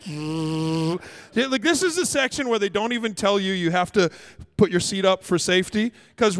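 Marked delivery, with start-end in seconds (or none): 0.0-1.5 low, drawn-out mechanical buzz; imitated motor buzz. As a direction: buzz like a seat motor reclining. Imitates the seat reclining motor.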